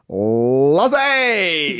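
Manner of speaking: calling the name out, drawn long; a bright staring hello from the colleague; colleague's loud greeting. Retold or imitated. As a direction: Lozzie called out loud and long, no small talk. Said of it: "she'd look you in the eye all the time and go".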